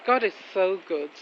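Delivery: ordinary talk, nothing marked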